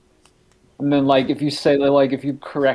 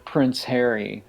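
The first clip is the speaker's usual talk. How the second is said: usual speaking voice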